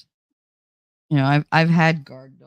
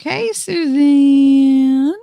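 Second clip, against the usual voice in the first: very slow, higher, louder sing-song hello; drawn-out greeting; 9 semitones higher, 6 dB louder, much slower than usual. Real, not acted. Greeting Suzanne, drawn-out sing-song. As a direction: stretch the hello out long and high. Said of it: "greeting a viewer by name".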